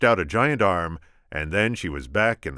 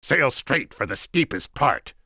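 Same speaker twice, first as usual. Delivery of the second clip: higher, slow imperious order; commanding; 9 semitones higher, 5 dB louder than usual. Acted. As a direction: give the order like a tyrant. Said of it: "the Sicilian commanded".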